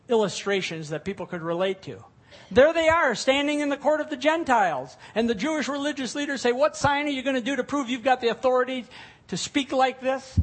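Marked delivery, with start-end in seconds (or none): 2.5-10.4 much louder and higher, driving; preaching at full force. Acted. Preacher picturing the temple scene for his flock, loud. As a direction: build it up for the congregation.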